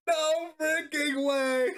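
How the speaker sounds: laughing through the words, slowly; laughing disbelief. Real, not acted. Unbelievable lucky draw.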